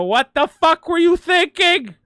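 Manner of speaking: high, loud, slowed hammed-up yell at the screen; mock rage scream. Shown as a demonstration. Ranting at a bad Star Wars movie for laughs, hammed-up scream. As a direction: bellow it like a furious fan losing it. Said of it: "It's funny to go".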